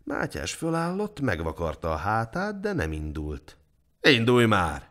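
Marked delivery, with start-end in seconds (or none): 4.0-4.9 loud, curt command; guard's raised voice; impatient order. A narrator's reading. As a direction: order him to get moving.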